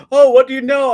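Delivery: high, loud sneering retort; high mocking jeer; mocking bar guy. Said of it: "I'm like".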